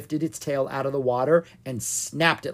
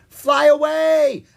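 high, drawn-out loud shout; crying out; 14 semitones higher, 10 dB louder, much slower than usual. A narrator's reading. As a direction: scream the warning, stretching the last word. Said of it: "cried out at the same time".

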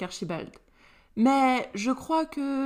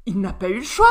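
louder indignant cry; exclaiming; 7 dB louder, faster than usual. A narrator's reading. Cassiopée defending Liam against his mother, indignant cry. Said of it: "s'écria Cassiopée".

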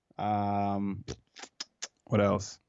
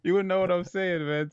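high-pitched, amused protest through laughter; real laughing, voice climbing high; 9 semitones higher than usual. Real, not acted. Joking nobody would understand his patois.

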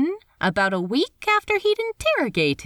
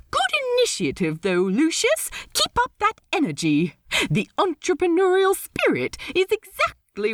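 upbeat captain; 10 semitones higher, faster than usual. Acted. Steed cheerful captain.

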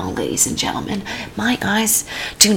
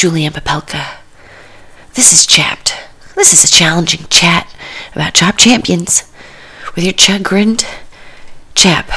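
ordinary talk, nothing marked

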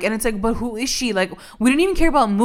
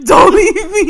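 higher and louder begging; hammed-up plea; mock plea; 9 semitones higher, 7 dB louder than usual. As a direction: beg like a melodramatic mom. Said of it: "She's like".

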